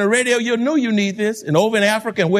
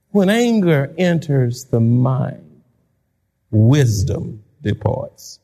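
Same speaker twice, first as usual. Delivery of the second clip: slow repeat; 8 semitones lower, much slower than usual. Shown as a demonstration. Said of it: "and I quote".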